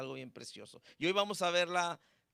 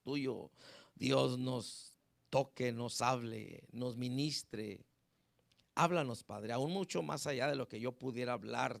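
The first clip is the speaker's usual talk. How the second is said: earnest prayer; 4 semitones lower than usual. Acted. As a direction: pray humbly to God before the sermon. Pastor leads opening prayer.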